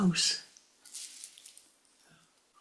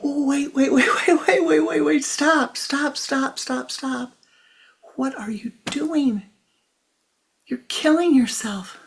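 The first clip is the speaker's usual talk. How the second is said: high, loud frantic cries of wait and stop, laughing through it; frantic high shout; 9 semitones higher, 8 dB louder than usual. A narrator's reading. Vak's wife rushes out to stop Vish hitting himself, frantic high shout. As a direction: shout at him to stop before he hurts himself.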